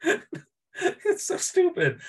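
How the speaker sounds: high, slow, laughing through the words; can barely get it out for laughing; real helpless laughter. Real, not acted. Laughing at the scene.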